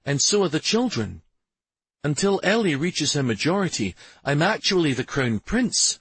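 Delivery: a hint of a firm crown prince; Stavin's voice. A narrator's reading.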